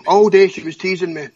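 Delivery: ordinary talk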